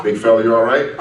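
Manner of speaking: loud, high coach calling out to his player; a coach hailing his big man across the gym. Retold or imitated. Coach to struggling player after practice, loud and high concerned call.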